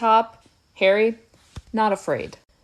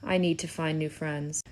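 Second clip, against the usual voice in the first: softer, mock-glum Ron; a mopey, lightly voiced Ron; glum mutter; 5 semitones lower, 7 dB quieter than usual. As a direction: sulk it under your breath like a fed-up sidekick.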